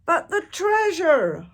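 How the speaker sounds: loud, high holler. A narrator's reading. Braidbeard hollering about the treasure, loud high dismay. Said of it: "hollered Braidbeard".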